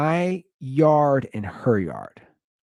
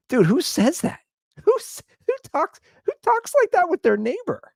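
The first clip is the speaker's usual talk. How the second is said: high-pitched, incredulous protest; baffled disbelief. Retold or imitated. Man reacting to his neighbor's oversharing, incredulous. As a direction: squeak it out, stumbling in disbelief.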